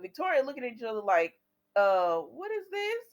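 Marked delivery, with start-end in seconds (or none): 1.7-3.0 baffled. Retold or imitated. Confused look between two characters.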